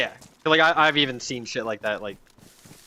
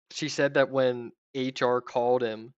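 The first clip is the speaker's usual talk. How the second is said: ordinary talk, nothing marked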